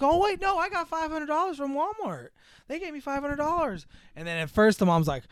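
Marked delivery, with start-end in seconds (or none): 0.0-3.9 much higher, eager blurting; naive excitement. Retold or imitated. Daughter proudly telling her mom about the money, high eager. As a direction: gush it out innocently. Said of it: "She's like".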